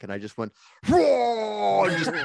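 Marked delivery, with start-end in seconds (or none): 0.9-1.8 retching whoa. Retold or imitated. Retching before hurling out van.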